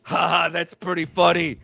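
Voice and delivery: low, hammy Brooklyn robot voice; dropped-pitch mock Brooklyn drone; Fauci bots. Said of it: "Fauci bots start going like".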